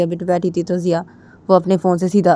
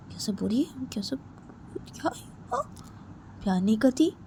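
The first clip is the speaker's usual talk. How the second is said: softer, half-whispered, tearful; hurt girl; 6 semitones higher, 6 dB quieter than usual. Acted. Sneha muttering to herself, hurt and tearful. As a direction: wonder aloud through tears.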